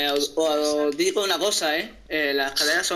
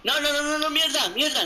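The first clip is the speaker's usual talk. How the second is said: panicked; 11 semitones higher than usual. Real, not acted. Player about to die in game.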